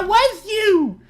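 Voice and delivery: high, loud laughing cry of surprise; delighted laughing shriek. Real, not acted. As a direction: caught the culprit, laughing. Finds out who funded it.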